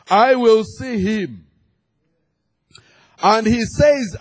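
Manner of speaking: loud, high, drawn-out scripture proclamation; full-force preaching. Real, not acted. Preacher proclaiming cleansing from all sin, forceful preaching. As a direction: ring each promise out to the congregation.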